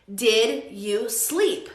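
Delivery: very slow, word by word; slow model question. Shown as a demonstration. Teacher repeats the corrected question, slowly spaced out. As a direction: separate DID, YOU and SLEEP clearly.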